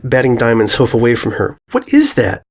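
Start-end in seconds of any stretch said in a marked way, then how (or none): 1.7-2.4 high startled question. Acted. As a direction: gasp at what she sees. Filly startled.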